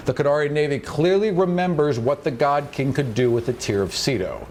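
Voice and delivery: usual speaking voice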